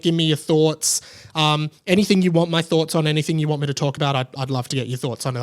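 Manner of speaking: ordinary delivery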